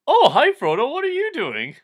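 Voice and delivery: high, bright, loud greeting voice; cheery Pippin. Acted. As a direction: greet Frodo in a high, loud, chirpy pitch. Pippin voiced while being drowned.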